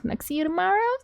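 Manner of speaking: high, soft hopeful question; tentative question. Retold or imitated. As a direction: lift TOMORROW into a timid question. Marinette's shy question, then the answer.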